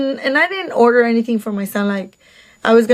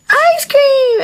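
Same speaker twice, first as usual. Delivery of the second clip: put-on high-pitched little-kid squeal; mimicked excited child; 4 dB louder than usual. Three-year-old hearing about ice cream, mimicked excitement. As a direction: imitate a small child thrilled at a treat.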